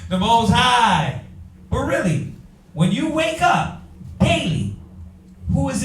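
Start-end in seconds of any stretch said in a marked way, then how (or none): 0.1-1.2 slow, weighty naming of God; solemn reverence; reverent. Acted. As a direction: savor each word. Reverent name, slowed.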